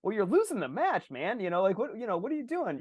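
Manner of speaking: mock exasperation. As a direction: roll your eyes at a showoff. Mocking overhitting player.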